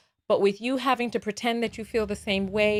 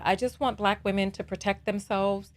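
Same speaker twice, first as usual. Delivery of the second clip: normal talking voice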